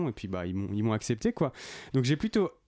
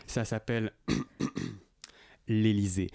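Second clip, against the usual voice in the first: ordinary delivery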